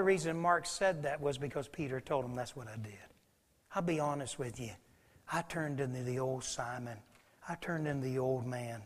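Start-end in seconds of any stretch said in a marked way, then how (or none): 2.3-8.8 quiet confession. Acted. Peter's confession, voiced low.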